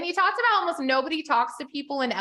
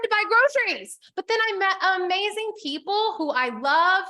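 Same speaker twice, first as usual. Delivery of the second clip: excited; 6 semitones higher, 4 dB louder than usual. Real, not acted. Emphatic personal story.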